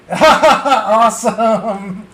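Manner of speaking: laughing awesome. Real, not acted. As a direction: burst out laughing with delight. Laughing reaction to dream scene.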